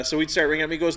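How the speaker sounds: usual speaking voice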